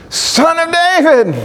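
very high, piercing call; shrieking out. Retold or imitated. Two blind men hailing the Messiah, shrieking. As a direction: shriek the title at the top of the voice. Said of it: "shrieking out with the top of their voices".

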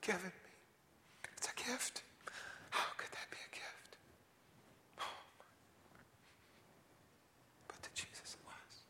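soft whispered awe; hushed wonder at the gift; reverent whisper. Acted. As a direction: lean in and whisper.

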